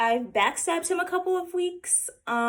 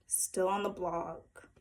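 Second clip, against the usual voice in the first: ordinary talk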